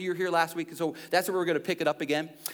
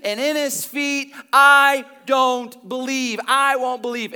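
slow, loud, higher defiant vow; defiant doubter; 6 semitones higher, 7 dB louder, slower than usual. Retold or imitated. Thomas refusing to believe, slow loud defiance. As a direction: plant your feet and refuse, drawn out. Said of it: "Thomas goes".

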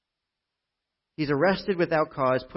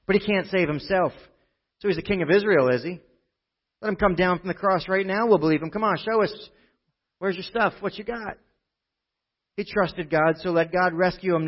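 scoffing mockery; 4 semitones higher, 6 dB louder than usual. A narrator's reading. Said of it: "they scoffed".

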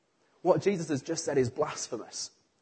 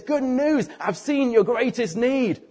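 high, loud and slow; joyful good news; 7 semitones higher, 3 dB louder, slower than usual. Acted. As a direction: announce it up to the roof with delight. Jesus addresses the man at his feet.

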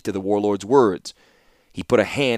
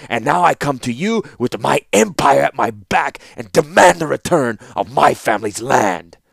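put-on louder, impassioned accusation in an accented voice; bitter hiss; 2 semitones higher, 5 dB louder than usual. A narrator's reading. Young ruler demanding his family's land from the warlord, bitterly. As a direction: build from grievance to a forceful demand. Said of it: "hissed the Kadir".

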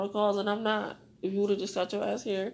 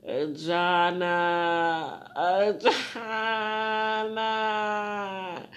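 calling out; 3 dB louder, much slower than usual. Real, not acted. Calling out the missed name.

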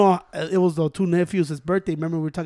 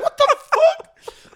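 high, drawn-out mock disbelief; réaction stupéfaite imitée; 6 dB louder, much slower than usual. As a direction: stretch it out, high and loud, imitating shock. Retold shocked reaction to Tito.

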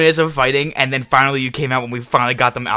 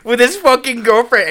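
excited; 12 semitones higher, 5 dB louder than usual. Retold or imitated. Excited retelling of a fight.

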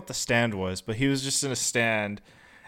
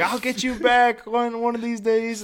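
hammed-up fist-shaking vow; fist-shaking threat; 10 semitones higher than usual. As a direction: imitate a comic underdog vowing payback. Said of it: "shaking his fist at her".

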